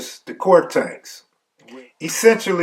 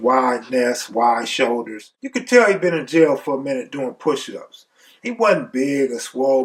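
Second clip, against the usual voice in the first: usual speaking voice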